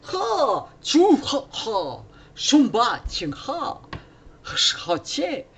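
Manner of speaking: high, loud kung-fu yells with pauses; martial-arts cries. Acted. Monk attacking three demons, mock kung-fu yells. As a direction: throw out sharp mock fighting shouts. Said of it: "I will do my Wing Chun".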